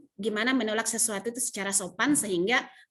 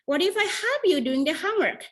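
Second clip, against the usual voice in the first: friendly offer; 7 semitones higher than usual. Shown as a demonstration. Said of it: "Gimana kalau saya bantuin".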